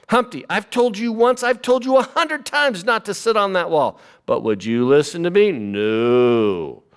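high nagging mom voice, dropping on the final no; the fed-up mother who's said it a hundred times. Acted. A mother nagging her son about the wall, high fed-up mom voice. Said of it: "how about Humpty Dumpty's mother?".

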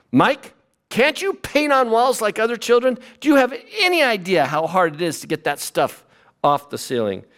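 high, scolding mom voice trailing off at the end; the mother who has to scrub the ceiling; exasperated mother. Acted. A mother scolding her son about painting the ceiling, high exasperated mom voice. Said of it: "how about Michelangelo's mother?".

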